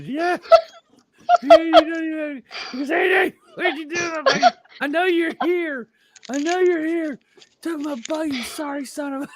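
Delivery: high, loud shouting with a laugh in it; hammed-up retold yell at a missing friend; mock yelling.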